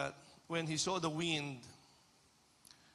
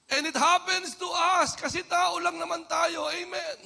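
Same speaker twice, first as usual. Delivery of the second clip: higher, louder pulpit delivery; impassioned preaching; 11 semitones higher, 9 dB louder than usual. Acted. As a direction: press the point on the congregation. Preaching at full force. (masidhing pangangaral)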